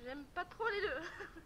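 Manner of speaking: slow, laughing complaint: « j'aime pas trop les deux »; half-laughing, half-whining, drawn out; laughing dislike. Real, not acted. Admitting she dislikes both flavours. (rire gêné et plaintif)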